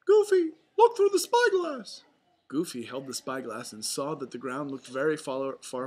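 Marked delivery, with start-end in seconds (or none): 0.1-2.0 very high, loud falsetto mouse; rally friends in a cartoon falsetto; Mickey Mouse. A narrator's reading. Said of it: "yelled Mickey".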